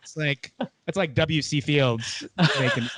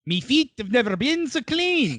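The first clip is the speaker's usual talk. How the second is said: put-on Irish accent; 10 semitones higher than usual. Mock Irish voice as character.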